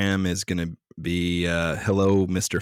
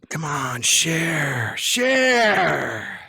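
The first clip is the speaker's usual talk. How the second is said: drawn-out, rising plea to share; playful urging; 9 semitones higher, much slower than usual. Pretend. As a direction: egg them on, stretching each word higher. Playfully urging listeners to share stories.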